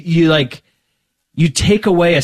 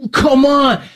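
higher-pitched, drawn-out "come on"; retold pleading push, pitched up; urging; 9 semitones higher than usual. Praying man prodding a girl who felt nothing, urging. Mock-urging her to feel something.

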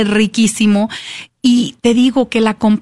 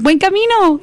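cheerful pilgrim greeting; 8 semitones higher than usual. Retold or imitated. Pilgrims cheering each other on.

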